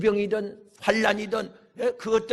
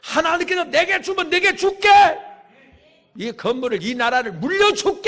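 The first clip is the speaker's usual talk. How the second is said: emphatic promise; 8 semitones higher, 5 dB louder than usual. Acted. Acts out father promising child, at full force.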